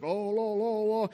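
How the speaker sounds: mock-pompous singsong "Oh, la, la, la"; King James voice. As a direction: put on a lofty, old-fashioned prayer tone. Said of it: "in this King James voice".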